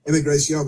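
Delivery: "immigration" with the ending "-see-ohn"; mock mispronunciation. Host repeating the mocked word, "immigration" mispronounced with "-see-ohn". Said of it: "which they have to mispronounce".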